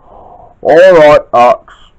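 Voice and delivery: loud, grudging reply; drawn-out, irritated storybook answer; cross. A narrator's reading. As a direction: give in crossly, loudly. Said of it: "said Chuck crossly".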